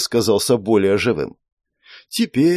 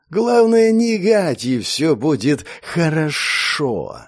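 serpent spirit voice; 3 semitones higher, slower than usual. Acted.

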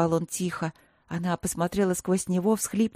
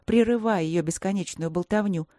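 plain reading voice

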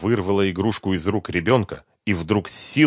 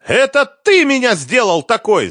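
very high, loud shriek of blame; furious scream; 9 dB louder than usual. A narrator's reading. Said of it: "снова закричала Лиза".

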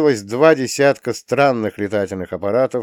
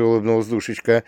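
ordinary delivery, nothing marked

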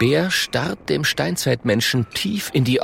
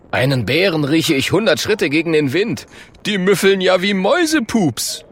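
higher, punchy blustering voice; boastful caveman; 8 semitones higher, 4 dB louder than usual. Acted. Klonk boasts with the bear behind him, boastful. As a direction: brag loudly with rising scorn.

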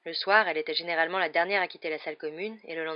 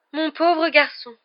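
much higher, louder, very slow lament; pitying seer; 10 semitones higher, 11 dB louder, much slower than usual. A narrator's reading. Trelawney looking up at Harry, slow high theatrical pity. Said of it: "murmura le professeur Trelawney dans un souffle".

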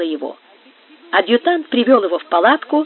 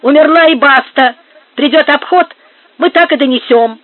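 loud, higher, blunt insistence; dismissive shout; 5 semitones higher, 7 dB louder than usual. A narrator's reading. Marcos telling Gomes how they will report it, shouting. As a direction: settle the matter brusquely and loudly. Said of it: "закричал Маркос".

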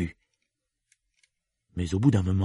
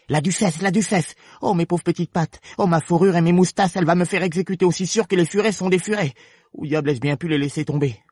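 high-pitched, panicky rabbit; lapin blanc affolé; 8 semitones higher than usual. Acted. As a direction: fret frantically in a high character voice. (lamentations affolées, voix haut perchée)

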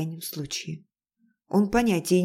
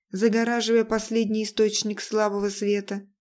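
plain reading voice

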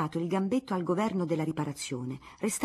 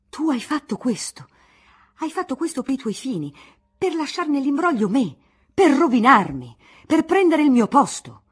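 rising from higher to a loud furious shout; violent exasperation; 7 semitones higher, 10 dB louder than usual. Acted. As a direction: build until you explode at him. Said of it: "stato d'esasperazione violenta".

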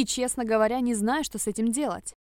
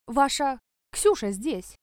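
slow, scared report; frightened; 3 semitones higher, 3 dB louder, slower than usual. Acted. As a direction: stammer it out in fear. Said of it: "По голосу управляющего ... от страха".

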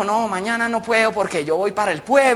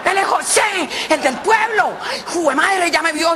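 high, panicky comic female voice; flustered woman; 10 semitones higher, slower than usual. Acted. Paola spots José from her village, panicking. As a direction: fret at being found out.

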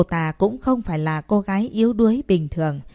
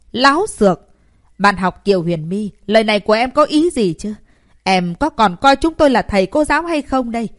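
angry rebuke; 3 semitones higher, 5 dB louder than usual. Acted. Teachers rebuking the pupil.

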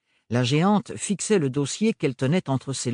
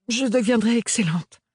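put-on higher, earnest young woman; nervous young woman; 10 semitones higher, 4 dB louder than usual. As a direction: promise it with nervous resolve. Nervous apprentice promises.

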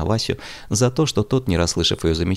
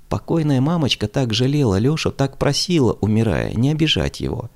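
sad reproach; 7 semitones higher than usual. A narrator's reading. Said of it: "с укором".

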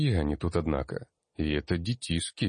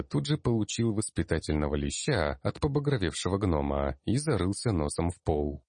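usual speaking voice

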